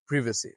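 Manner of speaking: প্রিভেসি with a short "pri", "PRIV-uh-see"; British privacy. Shown as a demonstration. Teacher sums up the British প্রিভেসি for viewers. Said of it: "এটার উচ্চারণ হচ্ছে".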